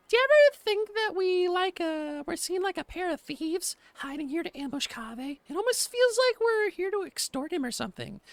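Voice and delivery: very high head voice for a game character. Shown as a demonstration. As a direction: read the line in a high falsetto character voice. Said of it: "I don't wanna do head voices like that".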